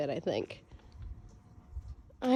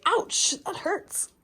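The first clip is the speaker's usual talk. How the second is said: sudden ouch; 10 semitones higher, slower than usual. Real, not acted. Cat claws her.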